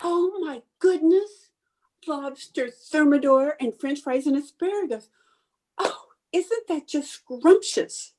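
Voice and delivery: high-pitched, savouring delight; delighted marvelling. Acted. Hostess unveils the lobster thermidor, delighted marvelling. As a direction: gush over it, pitched well up.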